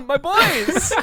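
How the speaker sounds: put-on high, playful call to beloved children; hammed-up parent crying out; mock doting parent cry. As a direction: call out high, laughing along. Calls blood cells home as parent.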